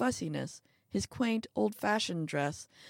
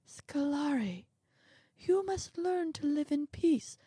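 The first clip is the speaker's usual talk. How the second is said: little man's plea; 9 semitones higher than usual. Acted. Little man counsels calm.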